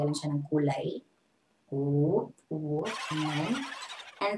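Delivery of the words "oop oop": ordinary delivery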